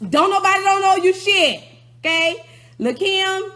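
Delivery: furious yelled catchphrase; angry shout. Real, not acted. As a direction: hammer it at her. Catchphrase repeated as outburst.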